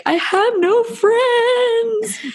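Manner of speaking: high, very slow, hammed-up wail; a self-mocking retold sob, drawn out and high; mock sobbing wail. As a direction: whine it out like a sulking, crying child. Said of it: "hysterically crying".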